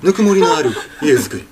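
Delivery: teacher shows Morimoto Leo's intonation. Shown as a demonstration. 森本レオの独特な抑揚で. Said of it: "独特のイントネーションが大事".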